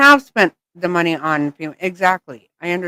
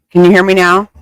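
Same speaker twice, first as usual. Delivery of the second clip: calling out loudly into the mic; loud mic check; 10 dB louder, faster than usual. Real, not acted. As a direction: raise your voice so they hear. Mic check, others can't hear.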